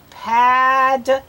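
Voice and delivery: PADDLE split into pad and dle with a gap; sounded out by syllables. Shown as a demonstration. Teacher helps a child decode, syllable by syllable. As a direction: break "Paddle" into two slow syllables.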